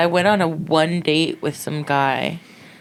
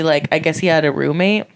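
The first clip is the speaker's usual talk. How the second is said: ordinary talk, nothing marked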